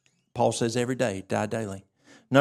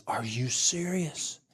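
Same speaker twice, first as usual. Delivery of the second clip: amazed patient, hushed; 6 dB quieter, slower than usual. Retold or imitated. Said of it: "He says".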